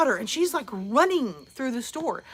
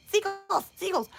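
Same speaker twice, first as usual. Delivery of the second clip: put-on kids calling; 8 semitones higher than usual.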